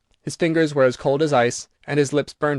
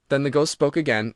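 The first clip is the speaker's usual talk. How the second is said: plain reading voice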